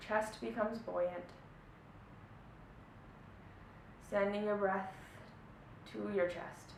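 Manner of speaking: soft, hushed meditation voice with long pauses; guided meditation. Acted. Teacher easing listeners into a body check-in, soft meditation voice. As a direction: guide them gently inward, leaving long silences between phrases.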